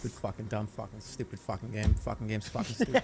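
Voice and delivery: low, quiet Boston grumble; grumbling Boston guy. Acted. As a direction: grouse softly as the Boston guy.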